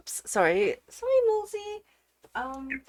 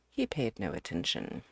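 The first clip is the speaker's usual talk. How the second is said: plain reading voice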